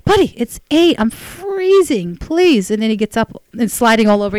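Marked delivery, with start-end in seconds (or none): 0.1-2.7 pleading. Retold or imitated. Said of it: "I'm like".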